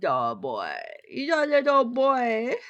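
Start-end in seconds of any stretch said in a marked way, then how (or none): none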